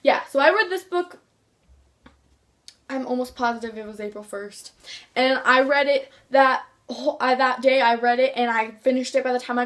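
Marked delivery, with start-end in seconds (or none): none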